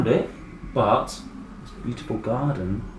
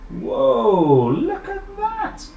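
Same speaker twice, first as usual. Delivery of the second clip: surprised; 13 semitones higher, 4 dB louder, much slower than usual. Real, not acted. Showing picture to children.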